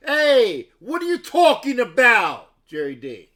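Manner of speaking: loud, high playful yell; mock shout. Streamer mock-yells at chatter Jerry D, loud. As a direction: bark it at a chatter in fun.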